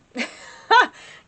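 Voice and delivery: a high, loud real laugh; burst of laughter. Real, not acted. Telling viewers that dating is horrible, with a loud laugh.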